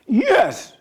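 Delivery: squeaky high yes; a high, eager cheer; excited high yes. Retold or imitated. Disciples cheering Christ.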